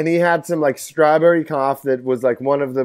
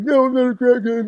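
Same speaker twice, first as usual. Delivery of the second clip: high whiny denial; 9 semitones higher than usual. Retold or imitated. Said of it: "he's like".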